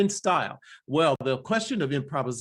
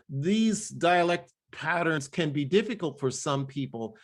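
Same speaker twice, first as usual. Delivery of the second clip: ordinary delivery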